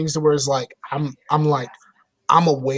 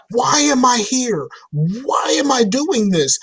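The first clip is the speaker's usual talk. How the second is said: exasperated yelling at TV; 9 semitones higher than usual. Retold or imitated. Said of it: "yelling at my T V".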